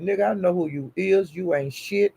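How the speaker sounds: ordinary talk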